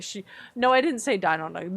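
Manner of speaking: normal talking voice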